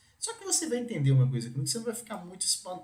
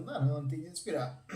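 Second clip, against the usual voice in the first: usual speaking voice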